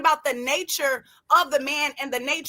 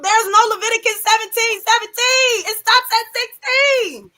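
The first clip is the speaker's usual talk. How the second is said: heated; 6 semitones higher, 5 dB louder than usual. Real, not acted. Heated rebuttal on the panel.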